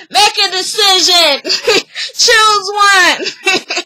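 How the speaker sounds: laughing, loud heckle from the back; mock heckle. [name] heckling Dante from the back room, laughing mock shout. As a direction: shout it out, cracking up as you go.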